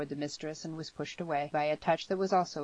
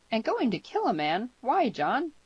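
put-on high, louder, incredulous girl; shocked girl's raised voice; appalled questioning; 8 semitones higher, 5 dB louder than usual. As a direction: plead for a reason, pitch swooping up.

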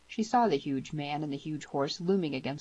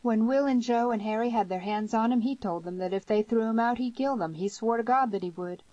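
higher, forceful story-character voice; character recounting a threat; 7 semitones higher, 5 dB louder than usual. A narrator's reading. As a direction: tell it urgently, pitched up and pushed.

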